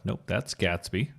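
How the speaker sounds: ordinary delivery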